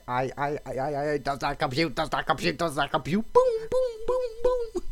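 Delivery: mock computer error meltdown; malfunctioning computer voice. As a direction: stammer like a crashing machine, then explode. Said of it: "it's like a Windows error".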